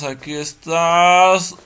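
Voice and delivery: high, loud slow call; loud drawn out. Real, not acted. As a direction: draw it out, calling loudly. Tense search in horror game.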